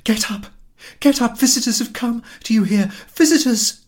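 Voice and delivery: very high, loud panicked cries; wife yelling at husband that visitors came; frantic shouting. Acted. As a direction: she is desperate to wake him.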